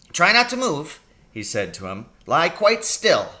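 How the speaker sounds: a brisk country doctor fussing over his patient; little old doctor voice. A narrator's reading. Doctor asking his injured patient about the pain.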